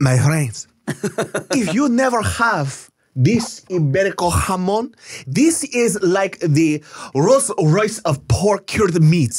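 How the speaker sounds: put-on Italian accent. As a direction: savour it slowly like an Italian food lover. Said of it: "we did an Italian accent".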